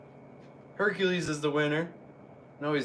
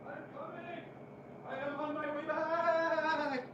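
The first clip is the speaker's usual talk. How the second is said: far off; 10 semitones higher, 16 dB quieter than usual. Real, not acted. Calling from another room.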